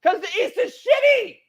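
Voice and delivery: exasperated emphatic retort. Real, not acted. Co-host answering why the East matters, exasperated high retort. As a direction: pitch it way up in exasperation. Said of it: "Why are you saying it like that".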